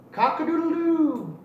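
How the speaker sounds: high, loud crowing rooster call. Acted. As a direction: crow it out like a proud little rooster. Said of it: "once again he cried".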